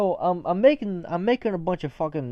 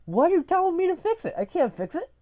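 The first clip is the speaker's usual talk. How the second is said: high-pitched bewildered complaint; exasperated protest; 13 semitones higher than usual. Retold or imitated. Worker told to fix a ruined towel, exasperated. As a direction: protest as if it's absurd. Acted protest about impossible task.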